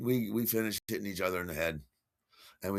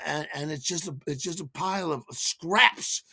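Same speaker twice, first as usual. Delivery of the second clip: ordinary talk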